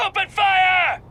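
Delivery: high, full-force battle shout; shouted attack order. Acted. Pirate ordering the crew to attack the ship, shouted. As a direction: yell the command to attack.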